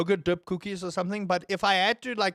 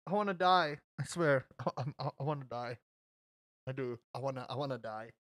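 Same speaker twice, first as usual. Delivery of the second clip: low, quiet muttered despair; mock despair; 6 semitones lower, 9 dB quieter, faster than usual. Streamer to chat, wanting to die, quiet mock despair. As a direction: mumble it softly, giving up. Groans at own stream, deadpan.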